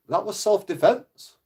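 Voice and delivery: high, slow, outraged protest; can't believe what he's hearing; indignant disbelief. Retold or imitated. Man hearing of his record, indignant.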